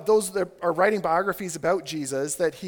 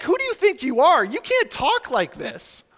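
high, affronted mock outrage; scolding, raised-pitch challenge; indignant challengers; 7 semitones higher, 6 dB louder than usual. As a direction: act out critics confronting an upstart. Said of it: "they're asking the question of him".

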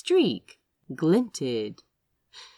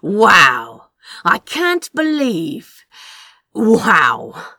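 amazed laughing wow; 2 semitones higher, 11 dB louder, slower than usual. A narrator's reading. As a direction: shout your amazement through the laugh. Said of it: "Crow had to laugh".

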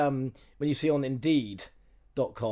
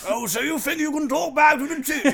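high-pitched, hammed-up challenge; mock explorer villain; 9 semitones higher than usual. Musket man confronting a pupil who talked back, hammed-up menace. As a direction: taunt like a musket-toting madman. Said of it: "the old school explorer guy with a musket".

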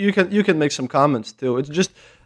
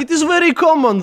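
heavy Russian accent, pitched higher; thick Russian accent; 13 semitones higher than usual. Shown as a demonstration. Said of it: "my thick Russian accent".

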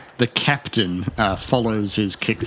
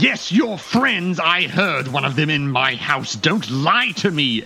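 slow angry insistence; 7 semitones higher than usual. Acted. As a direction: drag each word out in rage, pitched high. Captain angrily insists.

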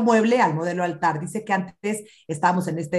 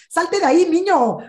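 parent yelling; 10 semitones higher, 7 dB louder than usual. Retold or imitated.

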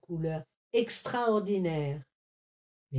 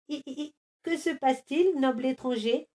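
worried; 7 semitones higher, faster than usual. A narrator's reading. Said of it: "s'inquiéta le panda".

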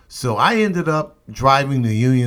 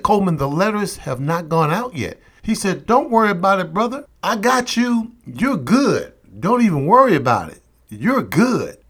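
reassuring sergeant; 7 semitones higher than usual. Retold or imitated. Recruiter reassures him.

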